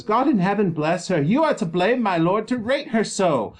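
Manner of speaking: put-on high, loud nurse defending the girl; protective nurse.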